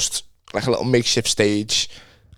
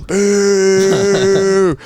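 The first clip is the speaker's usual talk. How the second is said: loud booing crowd; big mock boo from the room; 8 semitones higher, 5 dB louder than usual. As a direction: boo them off like a hostile crowd. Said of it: "every single person".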